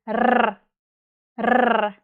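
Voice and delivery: long trilled "r" on its own; sustained rolling "rrr"; rolled R. Shown as a demonstration. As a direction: trill the "r" and hold it. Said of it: "we roll our R's".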